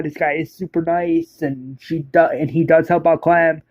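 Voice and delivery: ordinary delivery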